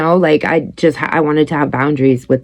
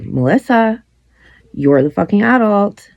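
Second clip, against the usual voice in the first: a hint of a scolding mother, higher; imitating mom; 7 semitones higher than usual. Mother quoting her own mom's scolding, lightly mocking. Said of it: "my mother that is constantly like".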